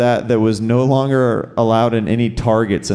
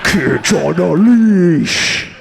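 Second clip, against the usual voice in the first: metal band announce; 5 semitones higher, 4 dB louder, much slower than usual. Pretend. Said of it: "Doesn't that sound like an awesome metal band?".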